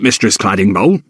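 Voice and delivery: "Mistress Claddingbowl" in Jacob's rough servant voice, rising at the end. Acted. Jacob addresses the cook.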